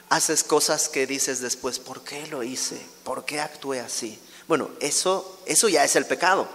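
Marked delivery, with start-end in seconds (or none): 1.8-4.2 acted quiet regret; regretful self-questioning. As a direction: quietly ask yourself in hindsight. Imagined regretful self-questioning afterwards.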